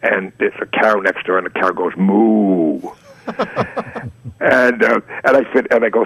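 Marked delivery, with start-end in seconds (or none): 2.0-2.8 cow moo. Retold or imitated. Cow in the retold commercial.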